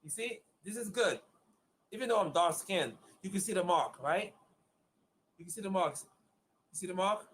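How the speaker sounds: normal talking voice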